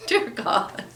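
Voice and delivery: ordinary talk, nothing marked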